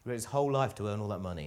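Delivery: ordinary talk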